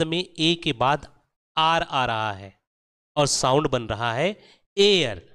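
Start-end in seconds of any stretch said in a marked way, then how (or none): 4.8-5.2 एयर said clearly as "air", pitched up; air sound. Shown as a demonstration. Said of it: "A plus R is equal to air".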